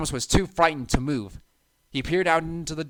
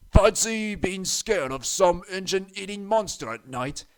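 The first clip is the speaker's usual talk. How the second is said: mocking; 6 semitones higher, 4 dB louder than usual. A narrator's reading. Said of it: "added Bert".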